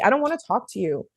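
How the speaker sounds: ordinary delivery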